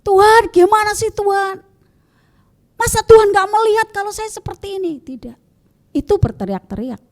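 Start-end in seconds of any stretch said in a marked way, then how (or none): 0.0-5.0 high, loud, indignant yelling at God; demanding complaint. Shown as a demonstration. Believer angrily yelling at God in hardship, indignant. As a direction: whine and protest as if God were ignoring you. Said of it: "Pasti kita tidak akan berteriak-teriak".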